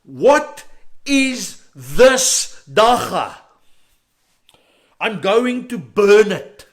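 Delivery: furious father yelling, drawn out and slow; angry dad shout. Retold or imitated. Father catches his son smoking and yells at him, furious shout. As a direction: bellow it like a father who caught his son. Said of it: "and he says to me".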